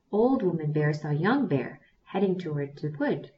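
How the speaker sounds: ordinary delivery, nothing marked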